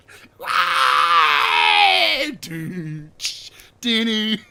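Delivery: loud, high shrieking cackle; screaming laugh. Real, not acted. Bursts out laughing.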